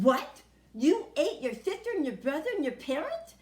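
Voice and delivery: loud outraged exclamation; teacher yelling in disbelief; shocked teacher. A narrator's reading. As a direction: cry out in horror as the teacher.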